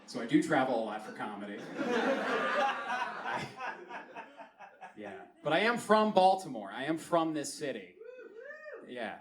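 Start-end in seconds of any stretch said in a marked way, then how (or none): none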